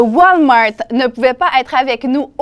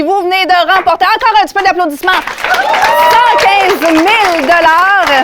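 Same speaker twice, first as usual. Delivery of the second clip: hyped giveaway announcement; 6 semitones higher, 3 dB louder than usual. Real, not acted. Announcing the grand prize.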